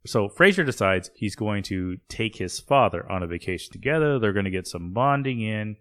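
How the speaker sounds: ordinary talk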